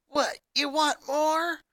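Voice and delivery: high, slow taunting jeer; mocking. A narrator's reading. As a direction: taunt him, daring him to come at you. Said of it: "she mocked".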